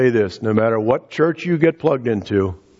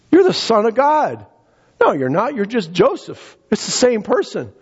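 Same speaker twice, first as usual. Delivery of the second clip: scoffing townsfolk; 7 semitones higher than usual. Acted. Voicing doubting townsfolk.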